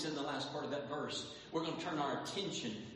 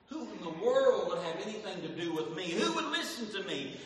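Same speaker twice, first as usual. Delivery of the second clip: Saul protesting, louder and higher; louder pleading Saul; 6 semitones higher, 6 dB louder than usual. Acted. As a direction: protest that nobody would listen. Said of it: "this is what Saul was saying".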